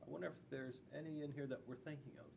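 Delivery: ordinary delivery, nothing marked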